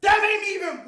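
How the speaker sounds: high, loud enraged yelling; furious shout. Real, not acted. Reliving the theft to chat, shouting in anger. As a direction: let the anger burst out again. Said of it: "now I'm reliving it".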